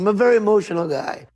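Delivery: usual speaking voice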